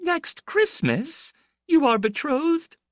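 very high, slow astonished cry; older woman stunned, tearful; tearful surprise. A narrator's reading. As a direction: gasp it out through tears. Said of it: "who was in tears herself".

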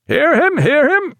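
very high cry from the crew; heckling call. A narrator's reading. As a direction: call out approval from across the deck. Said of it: "said somebody in the waist of the ship".

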